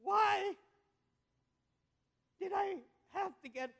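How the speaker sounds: whiny complaint rising high on why; a sweaty man griping at her; exasperated [name]. Acted. Exhausted [name] complains in joke.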